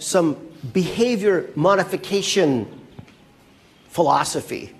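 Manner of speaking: usual speaking voice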